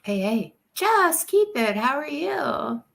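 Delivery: usual speaking voice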